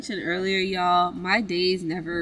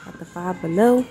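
usual speaking voice

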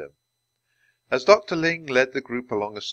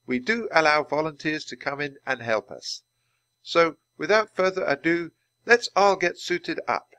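a lightly raised, welcoming scientist's voice; a hint of a polite guide addressing the group; lightly voiced Dr. Ling; 7 semitones higher than usual. A narrator's reading.

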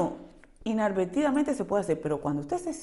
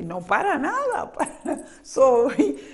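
laughing denial; 6 semitones higher, 5 dB louder, slower than usual. Real, not acted. Laughs at her own shyness claim.

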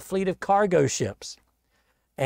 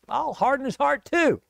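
higher-pitched defiant retort; God retorting; 9 semitones higher than usual. Acted. God answering Pharaoh's hard heart, retorting. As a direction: voice God matching a stubborn man, pitched up.

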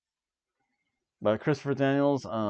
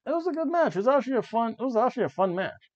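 much higher, bubbly enthusiasm; excited praise; 11 semitones higher, faster than usual. Real, not acted. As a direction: rave about the match in a high voice. Gushing about the match.

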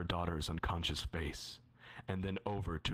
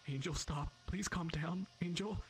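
pleading mother; 11 semitones higher than usual. Acted. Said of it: "She pleads".

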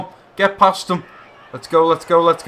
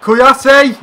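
high, loud cry of the name; excited shout; sudden shout; 9 semitones higher, 9 dB louder than usual. Real, not acted. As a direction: yell it as the chance comes.

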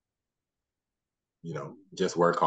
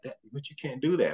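put-on higher, whiny kid voice; imitating protesting son; 9 semitones higher than usual. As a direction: sound like a child objecting in disbelief. Son protests dad leaving.

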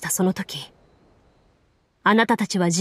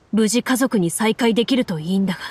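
usual speaking voice

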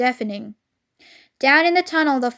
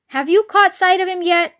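raised, higher-pitched question; calling back; 5 semitones higher, 4 dB louder than usual. A narrator's reading. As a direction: call the question across a distance. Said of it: "Bean called back".

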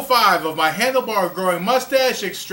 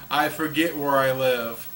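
laughing; 6 semitones lower than usual. Real, not acted. Host repeats his joke while laughing.

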